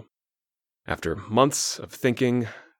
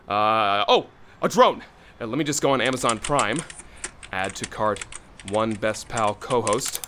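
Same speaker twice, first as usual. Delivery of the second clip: normal talking voice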